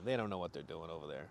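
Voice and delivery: very low, soft aside; mock-confidential dismissal; dismissive dad. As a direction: grumble it low under the breath. Joking dad dismissing the coach.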